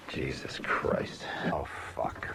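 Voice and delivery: whispered defeated swearing; whispered curse. Real, not acted. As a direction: mutter it under your breath. Cursing under his breath.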